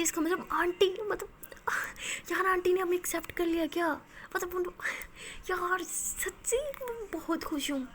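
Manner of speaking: bubbling over with happy disbelief; excited. Acted. Maya thrilled that aunt cares.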